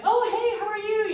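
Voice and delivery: very high, loud, cheery hello; overbright greeting. Retold or imitated. Greeting husband as he calls back, overbright. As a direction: burst out with a chirpy hello.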